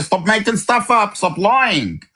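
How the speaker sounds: ordinary talk, nothing marked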